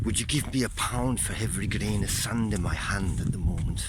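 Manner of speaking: put-on a deliberately poor Scottish old beach character; playing Ben in a bad Scottish accent. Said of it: "in a s-, bad Scottish accent".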